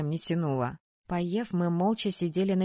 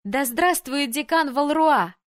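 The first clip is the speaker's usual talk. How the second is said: higher, louder, drawn-out cheer; joining the cheer; 6 semitones higher, 5 dB louder, slower than usual. A narrator's reading. Heroine joins the students hailing the dean, exultant cry. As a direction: take up the chant at full voice. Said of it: "подхватила их клич".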